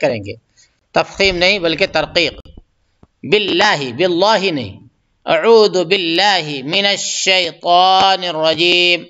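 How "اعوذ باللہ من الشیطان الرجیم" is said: slow, drawn-out tajweed chant; Quran recitation. Shown as a demonstration. Teacher reciting for students, slow tajweed chant. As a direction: recite it slowly and melodically.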